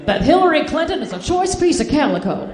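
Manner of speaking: ordinary talk, nothing marked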